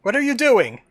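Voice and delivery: exasperated. Real, not acted. Scolding the lagging internet.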